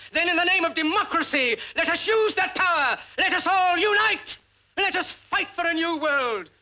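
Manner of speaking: rally the crowd; rousing call. Acted. Climax of a rousing speech.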